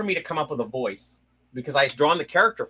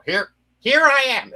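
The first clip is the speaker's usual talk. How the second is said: much higher, louder comic secret agent voice; Get Smart voice; 13 semitones higher, 7 dB louder than usual. Retold or imitated. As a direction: play it like a goofy spy announcing himself. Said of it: "turned into Get Smart".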